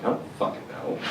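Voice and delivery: grumbled unvoiced reply; muttered dismissal. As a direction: brush her off under your breath. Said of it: "I'm like".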